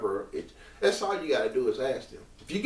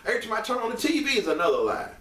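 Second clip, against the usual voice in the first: real fed-up outburst, pitched up; worked up; 10 semitones higher, faster than usual. Real, not acted. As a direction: burst out high and fast, exasperated. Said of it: "Let me calm down".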